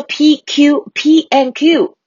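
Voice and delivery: slow, high teacher reading out P and Q; the teacher reading letters aloud in class, slow and high; mock teacher letters. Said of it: "老师说".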